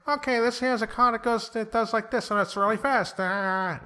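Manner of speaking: very high-pitched celebrity car-show host; Jay Leno impression. Podcaster mimics a car collector showing off, hammed-up Jay Leno impression. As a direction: squeal it out high and loud like a bad talk-host parody. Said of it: "I can't do a Jay Leno voice".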